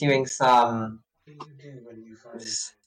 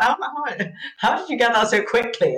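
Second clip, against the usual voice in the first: teasing mock amazement; 11 semitones higher, 4 dB louder than usual. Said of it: "I was like".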